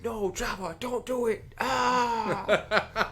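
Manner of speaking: plead, then cry out as you die; dying Hutt voice. Pretend. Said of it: "mimic the Hutt who's dead's voice".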